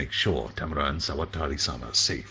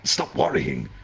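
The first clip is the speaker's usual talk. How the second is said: ordinary delivery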